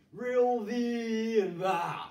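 loud, slow, overblown thespian voice; big phony Shakespearean. Acted. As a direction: ham it up like an old stage actor. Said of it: "I sounded big and phony".

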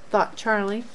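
ordinary delivery, nothing marked

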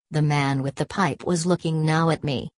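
usual speaking voice

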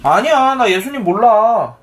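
high, drawn-out acted denial; emphatic denial. A narrator's reading. Peter answers the questioner by the fire, emphatic denial. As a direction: push the denial, stretching the end. 베드로가 다시 부인하는 대사.

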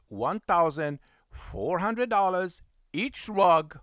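slow, measured restating of a price; deliberate repetition. Retold or imitated. Cleaner repeats the price to a shocked customer, slow and deliberate. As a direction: spell it out slowly, figure by figure.

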